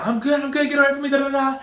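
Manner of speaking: very high, frantic mock panic; panicking man. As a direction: flail off everyone, squealing. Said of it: "he starts panicking".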